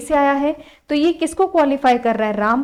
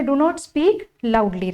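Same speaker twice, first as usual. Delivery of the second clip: very slow, word by word; slow dictation; much slower than usual. Shown as a demonstration. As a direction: read the options out for copying. Teacher dictating.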